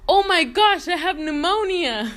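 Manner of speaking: high, loud melodramatic outcry; mock panic. Brother suddenly realizing he has pneumonia, mock panic. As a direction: suddenly cry out in exaggerated alarm.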